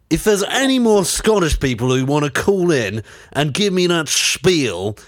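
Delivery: ordinary delivery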